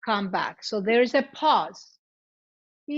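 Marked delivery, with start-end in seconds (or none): 2.9-3.0 sustained "ee". Shown as a demonstration. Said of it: "continue with the E".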